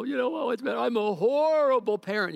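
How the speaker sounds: high-pitched imitation of a distraught parent; hammed-up wail of self-blame; mock crying. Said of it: "they're crying and they go".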